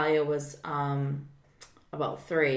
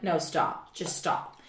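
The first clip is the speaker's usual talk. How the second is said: weary stop; 6 dB quieter, slower than usual. Retold or imitated. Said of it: "I'm like".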